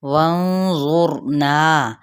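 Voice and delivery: وانظرنا very slow, the nun hidden with a nasal ikhfa; slow tajweed demo. Shown as a demonstration. Teacher sounds out وانظرنا for the class, nun hidden in a light nasal. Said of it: "نون پہ اخفاء حقیقی".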